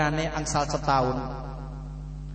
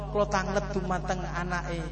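ordinary talk

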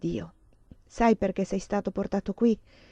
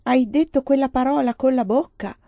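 incredulous outcry; 5 semitones higher, 7 dB louder than usual. A narrator's reading. Priest cries out at Lelia.

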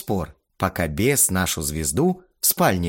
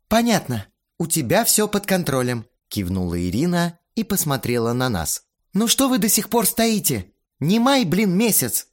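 higher, impatient woman in charge; bossy organizer voice; 8 semitones higher than usual. A narrator's reading. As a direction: chide them for dawdling. Irina's lines voiced.

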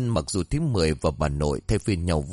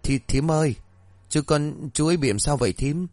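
stammering; 6 semitones higher than usual. A narrator's reading. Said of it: "lắp bắp".

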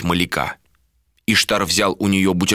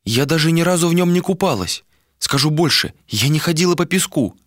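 higher female character voice, dreaming; wistful woman; 9 semitones higher than usual. A narrator's reading. Woman tells captain her sea dream, wistful higher voice. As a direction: confide the dream in a raised pitch.

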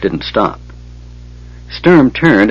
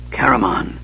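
softer, weak murmur of a name; 5 dB quieter than usual. A narrator's reading. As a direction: barely get the name out, softer. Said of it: "Raistlin murmured".

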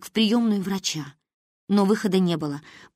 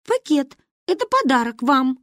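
put-on higher-pitched little girl reply; shy child; 7 semitones higher, 4 dB louder than usual. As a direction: offer it eagerly but shyly. Child timidly answers grandmother.

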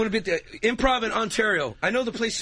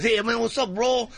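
put-on higher-pitched Chicano-accented voice; 5 semitones higher than usual. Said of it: "They talk like this".

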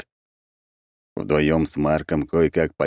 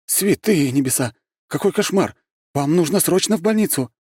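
high-pitched horrified exclamations; aghast, voice shooting up; alarmed; 12 semitones higher than usual. A narrator's reading. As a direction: cry out in shock and urge action. Healer shocked at woman's injuries.

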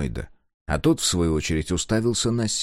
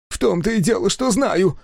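high, eager little man's voice; excited old engineer; 12 semitones higher than usual. A narrator's reading. Engineer gloating at Sega, high and eager. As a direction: crow it out high and thrilled.

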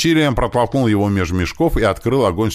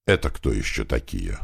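ordinary delivery, nothing marked

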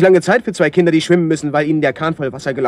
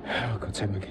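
relief; 8 semitones lower, 13 dB quieter, slower than usual. Acted. Relief after finding friend.

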